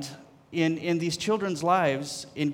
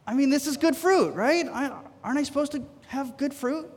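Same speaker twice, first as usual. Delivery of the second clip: high, hurt protest to God; complaining to God about being kept home, upset and high; upset pleading; 10 semitones higher than usual. Retold or imitated. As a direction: plead as if wronged. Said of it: "I kinda got upset about it".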